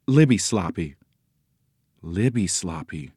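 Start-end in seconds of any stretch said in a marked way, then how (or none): 2.0-3.1 soft, lower echo; quiet, wondering echo; awed repetition. A narrator's reading. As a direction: repeat the name in hushed wonder. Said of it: "Nancy repeated, her eyes wide".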